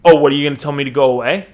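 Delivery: fast, loud challenge; acted confrontational retort. Connor rounds on the stranger who touched their shoulder, confrontational. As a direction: throw it out loudly and quickly.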